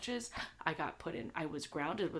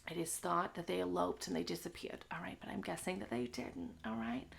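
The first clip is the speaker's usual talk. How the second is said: whisper; 3 dB quieter than usual. Real, not acted. Conspiratorial synopsis aside.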